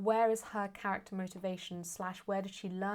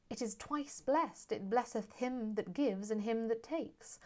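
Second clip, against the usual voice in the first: slow, lifted Shakespearean verse; declaimed stage verse, pitched up; verse recitation; 3 semitones higher, slower than usual. Acted. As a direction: let each line of verse ring out unhurried. Recites Portia's speech aloud.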